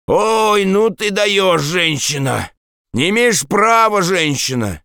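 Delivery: high, wailing protest, falling away; drunk man in pain. A narrator's reading. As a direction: whine indignantly after a painful blow. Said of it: "ему стало так больно". (жалобное пьяное возмущение от боли)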